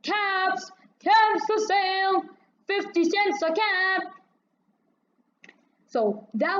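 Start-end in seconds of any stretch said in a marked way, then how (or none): 0.0-4.0 high sing-song street call; peddler's call. Acted. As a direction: call your wares down the street. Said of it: "he walked back to town calling".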